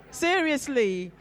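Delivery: laughing. Real, not acted. Laughing at audience reaction.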